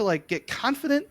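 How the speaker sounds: ordinary delivery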